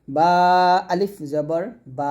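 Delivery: slow recitation, long aa on "বা"; drawn-out vowel. Shown as a demonstration. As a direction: stretch the final "বা" out. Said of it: "এরকম আমরা টান দিব".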